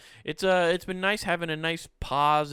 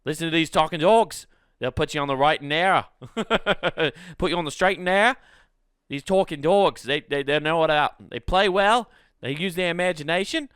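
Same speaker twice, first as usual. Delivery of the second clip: louder, higher mock-Australian voice; Australian accent; 4 semitones higher, 5 dB louder than usual. Australian TV telling adults to behave, in a put-on Australian accent. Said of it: "it's Australian".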